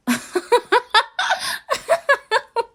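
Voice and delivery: long, loud, high cackling laugh; unhinged laugh. Acted. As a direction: laugh as if the idea is insane. Captive says home, she laughs.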